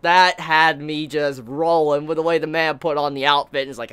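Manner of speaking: ordinary delivery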